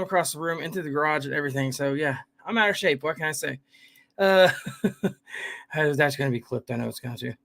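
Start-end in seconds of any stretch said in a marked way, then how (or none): none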